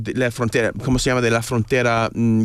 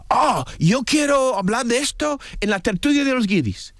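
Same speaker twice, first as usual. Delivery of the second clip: much higher, bursting with eagerness; eager inner thought; 8 semitones higher than usual. Retold or imitated. Guest replaying his own urge on the street, high and eager. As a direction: blurt it out like a sudden itch to talk.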